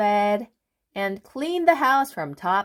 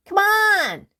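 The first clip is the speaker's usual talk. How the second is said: much higher, louder call; calling cry; 14 semitones higher, 6 dB louder than usual. Acted. Mister Busy calls his friend again, loudly. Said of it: "cried Mister Busy again".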